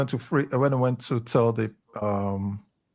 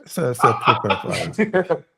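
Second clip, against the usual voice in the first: laughing; slower than usual. Real, not acted. Jokes a low score.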